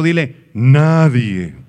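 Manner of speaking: nadie slow and loud; prompted answer. Shown as a demonstration. Preacher giving the church a word to tell their neighbour, slow and loud. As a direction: drag out nadie for the neighbour to repeat. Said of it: "Voltea con el de junto".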